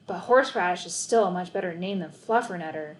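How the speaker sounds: usual speaking voice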